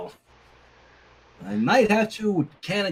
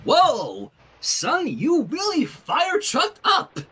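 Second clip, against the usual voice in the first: exclaiming; 8 semitones higher, much slower than usual. Acted. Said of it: "Rainbow Dash exclaimed".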